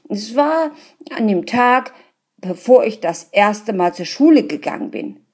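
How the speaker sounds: indignant insisting. A narrator's reading. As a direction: insist angrily to the gentlemen. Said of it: "rief der Mann empört".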